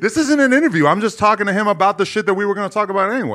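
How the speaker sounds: high, loud, hurried insistence; loud protest. Real, not acted. Host defending his question to co-hosts, loud protest.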